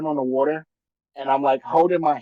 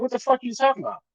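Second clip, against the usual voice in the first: high, fast startled retort; quick panicked what-are-you-talking-about; startled outburst; 8 semitones higher, much faster than usual. Retold or imitated. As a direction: blurt it out in alarm. Retold panic at manager.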